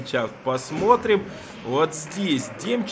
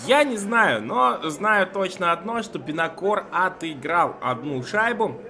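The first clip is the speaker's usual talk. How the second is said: excited; 7 semitones higher, 4 dB louder than usual. Real, not acted. Reacts to a surprise goal.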